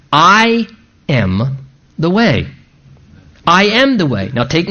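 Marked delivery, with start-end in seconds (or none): 0.1-2.5 I, am, the way set apart by pauses, I lifted; pauses between words. Shown as a demonstration. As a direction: spell out "I am the way" one slow word at a time. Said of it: "Here it is, four words".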